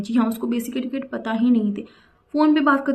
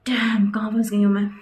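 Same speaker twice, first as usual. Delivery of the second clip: whisper; 2 semitones lower than usual. Retold or imitated. Trapped in bathroom, muttering to herself.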